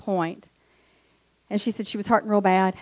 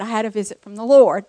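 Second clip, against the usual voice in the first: ordinary talk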